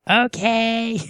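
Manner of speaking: put-on high, sweet child's okay; little girl voice. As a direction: chirp it like a young daughter. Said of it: "And she'll go".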